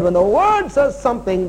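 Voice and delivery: "word" lifted and punched; stress on word. Real, not acted. Preacher repeating his point to the church, "word" stressed. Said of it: "I'm emphasizing the word".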